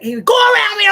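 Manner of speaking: husband shouting at the other driver to go around; yelled command. Retold or imitated. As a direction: shout it at the passing car. Husband yelling at other car.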